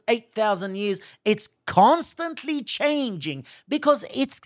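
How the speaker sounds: high, loud fiery sermon; preaching at full force. Real, not acted. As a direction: drive it home to the congregation, full force.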